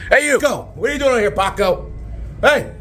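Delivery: high-pitched holler at a stranger; calling out. Acted. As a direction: shout over to get his attention.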